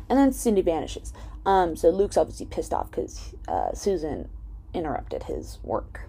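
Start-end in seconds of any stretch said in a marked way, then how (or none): none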